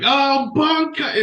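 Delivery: high, long drawn-out mock power call; hammed-up anime attack shout; retold battle cry. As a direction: stretch it out in a high mimicking cry. Retold battle cry of dying character.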